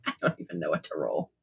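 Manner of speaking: low, soft, worn-out mutter; drained mumble. Real, not acted. Player exhausted after a big spell, low and soft. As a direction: sag into the words.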